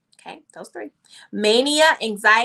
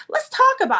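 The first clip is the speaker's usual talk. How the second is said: high gentle voice; 13 semitones higher, 6 dB louder, faster than usual. Retold or imitated. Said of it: "I like that, Rose".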